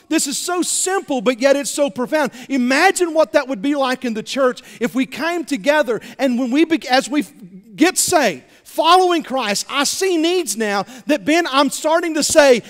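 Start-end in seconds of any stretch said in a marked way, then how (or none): none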